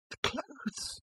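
breathy awed whisper. A narrator's reading. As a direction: whisper it in wonder. Awed listing, hushed.

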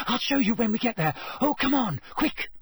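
high, hurried urging; breathless, eager insistence; urgent. A narrator's reading. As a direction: hurry them along impatiently, pitched high. Breathless girl urging friends along.

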